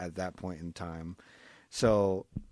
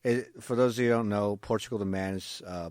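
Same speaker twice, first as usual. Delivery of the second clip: normal talking voice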